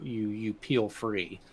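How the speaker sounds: ordinary talk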